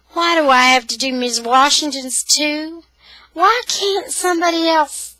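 put-on high, loud child's protest; whining complaint. A narrator's reading. Boy to Daddy about mowing Missus Washington's too, whining.

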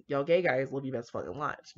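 plain reading voice

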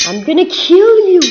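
high, loud battle cry; yelled threat. Real, not acted. Gamer threatening an opponent, yelled battle cry. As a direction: holler it at the enemy.

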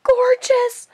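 slow, high, near-tearful gush over the new horse. Real, not acted. Unboxing a model horse for viewers, gushing. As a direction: melt over how gorgeous she is. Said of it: "oh my gosh".

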